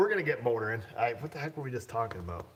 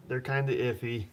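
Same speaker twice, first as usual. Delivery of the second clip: usual speaking voice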